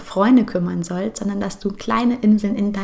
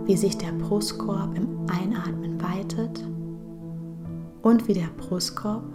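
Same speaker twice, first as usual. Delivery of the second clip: guided meditation; 6 dB quieter than usual. Acted. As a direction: guide her gently into calm, breath by breath.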